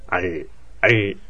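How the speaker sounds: アル said slowly, stopping sharply on the final ル sound; slow アル, cut off before the vowel; clipped final l. Shown as a demonstration. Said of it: "アルって止めると". (「アル」をゆっくり、ルで止めて)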